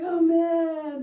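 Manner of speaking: loud wailing oh man; dismayed cry. Retold or imitated. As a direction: wail over the spilled beer. Guy spilled his beer.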